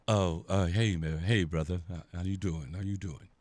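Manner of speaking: low, soft, sheepish mumble; suddenly meek and low; mock timid greeting. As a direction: imitate men shrinking back as they say hello. Shaken men greet the host.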